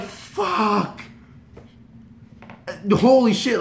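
high, loud overwhelmed swearing; nervous outburst. Retold or imitated. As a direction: swear as nerves boil over. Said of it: "the most nervous I've ever been".

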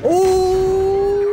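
very high, loud thrilled cry; excited ooh. Real, not acted. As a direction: squeal with delight. Gunfire hit, excited exclaim.

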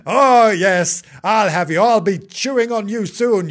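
loud, gleeful threat; menacing dog. Acted. Dog promising to chew the kitten, menacing. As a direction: gloat loudly over the prey.

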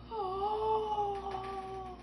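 long, high, soft "oh"; drawn-out wonder. Pretend. Host opening the box for children, high drawn-out wonder. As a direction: hold one high note in play amazement.

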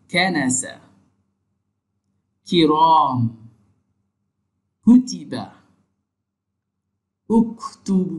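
কানাসা, কিরাম, কুতিবা, উকতুব said with a clear Arabic kaf; Arabic word demo. Shown as a demonstration. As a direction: recite the words carefully. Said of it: "শাব্দিক উদাহরণ দেখি".

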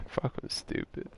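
unvoiced, soft self-scold; muttered self-scold. Real, not acted. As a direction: whisper it at yourself. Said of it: "Sorry for swearing".

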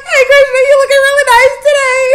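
very high, loud, fast squeal through laughter; giggling mock adoration of Krishna; laughing gush. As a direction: squeal a compliment while cracking up. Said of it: "I'm like".

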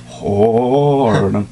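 usual speaking voice

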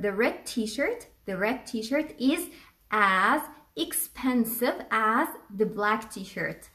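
very slow, word-by-word model sentence; unhurried classroom example; slow dictation. Shown as a demonstration. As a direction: read it out slowly for learners. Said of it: "هقول مثلًا".

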